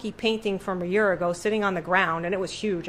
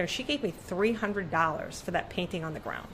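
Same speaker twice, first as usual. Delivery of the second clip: ordinary talk, nothing marked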